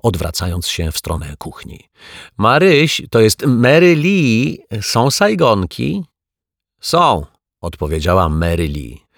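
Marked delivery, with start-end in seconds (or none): 2.4-6.0 loud, higher call toward the kitchen. Acted. Waiter calls to the kitchen. 6.8-7.3 calling back. Acted. Cook answers from kitchen.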